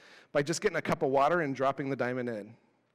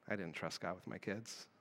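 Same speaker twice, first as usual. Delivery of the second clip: much softer and lower, an honest admission; quiet confession; 8 semitones lower, 15 dB quieter than usual. Real, not acted. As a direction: drop the voice and own the truth. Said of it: "You know what that showed me?".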